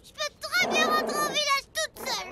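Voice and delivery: high, insistent protest; angry protest. Acted. Girl refusing help, angry and high. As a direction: shake him off and insist.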